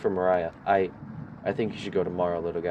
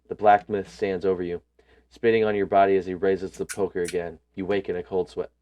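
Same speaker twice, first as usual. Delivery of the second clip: ordinary talk, nothing marked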